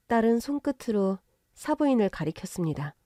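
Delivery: usual speaking voice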